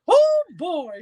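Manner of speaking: very high, loud cartoon exclamation; gleeful mascot impression; Mickey Mouse. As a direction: squeal it in cartoon mouse falsetto. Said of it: "he hated the Mickey Mouse".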